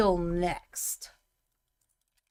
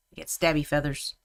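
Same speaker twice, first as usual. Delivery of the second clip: ordinary delivery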